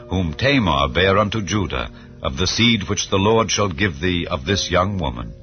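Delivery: ordinary delivery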